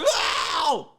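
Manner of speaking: loud high mock yowl; hammed-up cry of pain; imitated pained howl. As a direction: yowl like an overacting bystander. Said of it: "it's like a member of the public".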